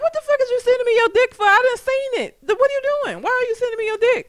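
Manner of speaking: high, fast, indignant squawk; outraged. Retold or imitated. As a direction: shriek it out, appalled. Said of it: "I'm gonna be like".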